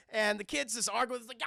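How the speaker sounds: ordinary delivery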